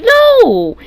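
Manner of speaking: high, loud, drawn-out no; emphatic no. Retold or imitated. To the apologizing mom, a loud high refusal. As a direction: shut the offer down loudly.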